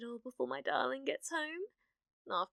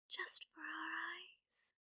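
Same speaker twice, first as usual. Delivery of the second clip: whisper; 6 semitones higher, 11 dB quieter, slower than usual. Acted. Secret possessive murmur.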